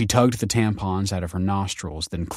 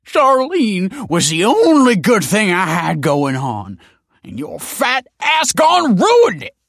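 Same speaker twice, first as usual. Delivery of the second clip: angry man rant; 13 semitones higher, 9 dB louder, slower than usual. Acted. Tony rants at Stacy.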